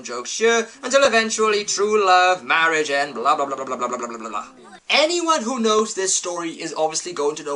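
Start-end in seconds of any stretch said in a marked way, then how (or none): 3.1-4.5 falling, trailing-off blahs; bored running-down string of filler; dismissive blah-blah. Real, not acted. Dismissing the rest of plot.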